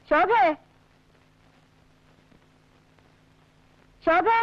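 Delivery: high, rising repeated calls; calling out. Acted. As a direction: keep calling someone who doesn't answer. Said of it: "ഈ വിളിച്ച വിളിയൊക്കെ". (വീണ്ടും വീണ്ടും നീട്ടി വിളിച്ച്)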